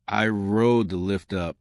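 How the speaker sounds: ordinary delivery, nothing marked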